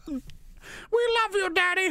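put-on very high, drawn-out child voice; adoring children. Children telling the leader they love Daddy, squeaky adoring. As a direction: coo it like little kids to their father.

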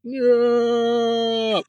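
long, drawn-out high "no" in mock slow motion; slow-motion scream. Mom flies over the sunroof, mock slow-motion scream. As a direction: stretch it out high like a slowed-down film. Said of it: "it's in slow motion".

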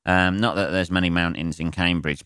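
normal talking voice

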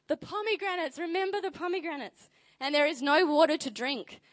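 mock whine. Preacher mocking Israelites moaning about pomegranates, high mock whine. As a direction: play the grumbler for laughs.

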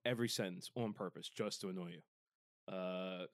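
low creaky vocal fry. Pretend. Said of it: "they don't have a vocal fry filter".

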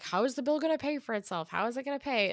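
Manchin. Retold or imitated. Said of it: "Manchin's constantly like".

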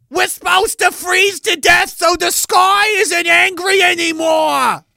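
hammed-up hysterical activist yelling high and loud; mock panicked activist shout. Mocking climate zealots, shouts high and loud in a panic. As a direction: shriek it like a zealot begging for the power cut.

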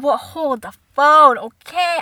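loud, drawn-out mock halt; indignant interruption. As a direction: cut them off with slow, loud emphasis. Child interrupts parents' wrong guess.

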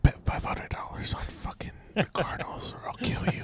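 urgent, threatening whisper; frantic whisper. Acted. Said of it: "Why are you whispering?".

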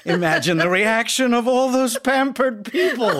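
laughing loudly while telling; story breaks into big laughter; laughing through pain. Acted.

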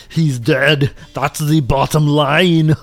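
slow Arnie one-liner with goofy laughter; hammy Arnold impression; Schwarzenegger quip. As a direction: deliver a drawn-out action-hero pun, then laugh in character. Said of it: "looks at it and he goes".

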